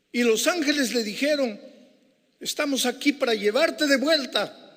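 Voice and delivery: high, loud sermon climax; preacher building to the climax, loud and high; full-force preaching. Acted. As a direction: drive it home to the congregation.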